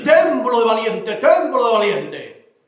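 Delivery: lento y más fuerte, pecho inflado; fanfarrón. Acted. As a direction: repite la bravata despacio. Muletilla de Xico. (bravata de Xico, lenta y fuerte)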